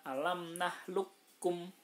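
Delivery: "nahlukum" with the qaf dropped and the kaf doubled; "nahlukum" read slowly as tajwid, k sound held double; merged qaf into kaf. Shown as a demonstration. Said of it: "kofnya dihilangkan, kafnya ditasdidkan".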